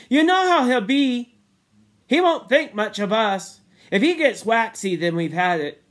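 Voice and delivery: high-pitched worried warning; timid but loud, falling toward the end. A narrator's reading. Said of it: "said Sam timidly".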